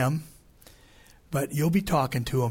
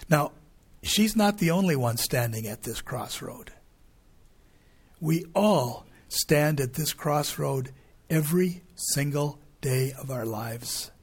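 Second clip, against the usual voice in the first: ordinary talk, nothing marked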